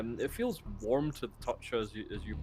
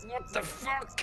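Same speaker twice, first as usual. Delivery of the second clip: much higher startled cry; shocked wounded man; slower than usual. Acted. Man shot by the bullet, high shocked cry. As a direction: yelp in disbelief.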